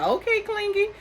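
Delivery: high, teasing sing-song "okay, Clingy"; mock teasing. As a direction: poke fun at him in a high mocking tone. Mocking a clingy guy.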